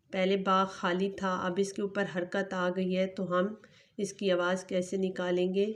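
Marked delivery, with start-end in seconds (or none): none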